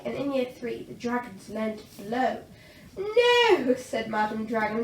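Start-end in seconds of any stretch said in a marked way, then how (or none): 3.0-3.7 much higher, louder no. A narrator's reading. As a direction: cut them off firmly. Madam Dragon corrects class.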